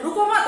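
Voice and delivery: no break inside रुको मत, stop after मत; रुको मत grouped. Shown as a demonstration. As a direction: run रुको straight into मत. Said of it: "मतलब तुम यहाँ रुको मत".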